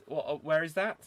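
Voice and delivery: usual speaking voice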